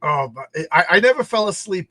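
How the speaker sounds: ordinary talk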